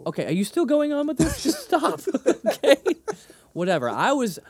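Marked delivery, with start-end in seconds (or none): 0.1-2.8 pitched-up, loud mock exasperation; high exasperated laugh. Real, not acted. Begging partner to drop the joke, high and exasperated.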